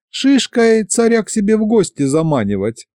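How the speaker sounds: put-on lightly indignant, high-pitched girl. A narrator's reading. Masha wrinkles her nose, indignant.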